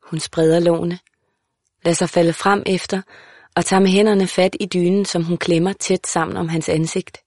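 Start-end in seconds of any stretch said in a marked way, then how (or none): none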